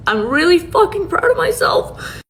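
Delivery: real tearful laugh-cry. Real, not acted. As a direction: voice breaking as she repeats it. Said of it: "But you cannot cry".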